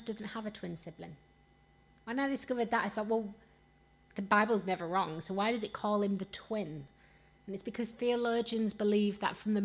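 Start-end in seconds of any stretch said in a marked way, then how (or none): none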